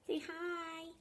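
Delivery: high, hushed puppy talk. Real, not acted. Asking her puppy to greet the viewers, high and hushed. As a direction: softly coax the dog to say hi.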